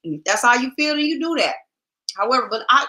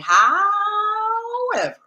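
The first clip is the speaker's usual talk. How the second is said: drawn-out however; 13 semitones higher, much slower than usual. Acted. Drawn-out word.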